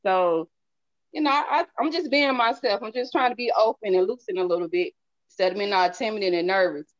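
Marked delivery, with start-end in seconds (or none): none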